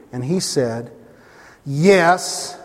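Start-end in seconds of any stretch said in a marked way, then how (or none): 1.7-2.6 a loaded, meaningful yes; knowing yes. Retold or imitated. Reference call, a knowing loaded yes. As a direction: say yes so the caller reads between the lines. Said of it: "You hear the inflection?".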